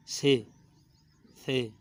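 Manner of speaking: "سے" as "say", with an s sound; wrong tha pronunciation. Shown as a demonstration. Teacher shows common misreading, s-sound "سے". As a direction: misread the letter as sibilant "سے". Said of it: "اکثر غلط".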